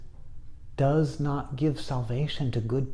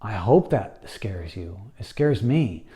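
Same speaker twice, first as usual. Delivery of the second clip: ordinary delivery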